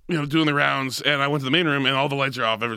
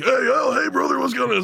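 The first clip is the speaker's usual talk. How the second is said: cheery wrestler greeting; 12 semitones higher than usual. Retold or imitated. Voices Roddy greeting.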